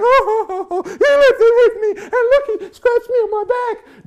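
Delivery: high, hammed-up mock sobbing whine; mock crying. Preacher mocking complainers with high fake sobbing. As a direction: pretend to cry like a big baby. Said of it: "start crying".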